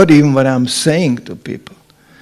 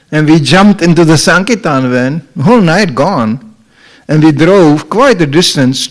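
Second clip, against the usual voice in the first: ordinary talk, nothing marked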